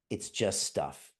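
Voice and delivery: reassuring dad. Retold or imitated. Calming scared sons after breakage.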